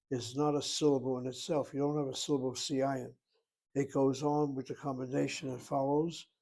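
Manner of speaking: usual speaking voice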